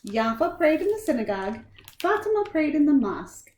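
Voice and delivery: lightly lilting read-aloud voice; storybook reading. Acted. Reading a picture book to children, gently lilting. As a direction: tell it gently to young listeners.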